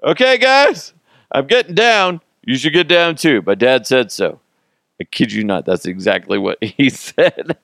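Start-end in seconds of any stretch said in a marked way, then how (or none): 0.0-4.4 high, loud imitation of a young son; kid voice. Son announcing to his friends, high kid voice. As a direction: chirp it like an eager little boy. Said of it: "He goes".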